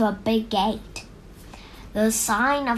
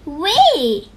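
louder happy squeal; excited shout; 4 semitones higher, 8 dB louder than usual. A narrator's reading. Sally riding the roller coaster down, squealing with glee. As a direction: let it fly as you plunge. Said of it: "Sally shouted".

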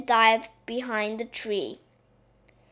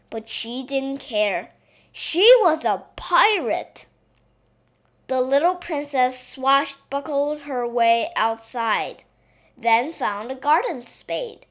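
ordinary delivery, nothing marked

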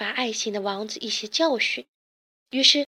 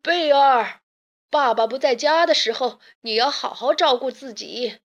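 louder fatherly character voice; loud parting advice; 3 semitones higher, 4 dB louder than usual. A narrator's reading. Father turns back to instruct his daughter, calling loudly. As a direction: call back with raised voice. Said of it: "回头大声地嘱咐女儿".

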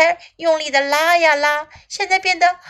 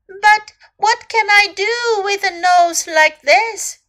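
high-pitched upset little elephant; storybook elephant's plaintive cry; 7 semitones higher, 5 dB louder than usual. A narrator's reading. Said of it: "cried little elephant".

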